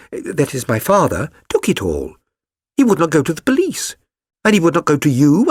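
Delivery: a touch of huffy pique; Mary Sutherland voiced. A narrator's reading.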